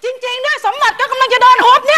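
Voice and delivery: high agitated shout. Acted. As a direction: yell in alarm. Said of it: "ตะโกนทำไมเนี่ย". (ตะโกนเสียงสูงอย่างตื่นตกใจ)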